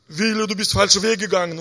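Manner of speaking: a loud, mock thundering voice of God; booming voice from heaven. Preacher imitating God thundering from heaven, loud. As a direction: boom it down like a voice from the sky. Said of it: "und vom Himmel so kommt der Dach auf".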